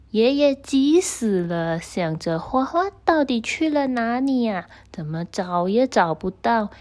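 ordinary delivery, nothing marked

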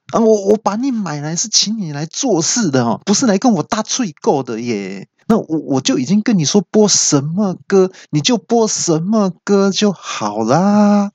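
high, exasperated scolding of a gadget; mock irritated rant at the assistant; annoyed scolding. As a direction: tell the machine off.

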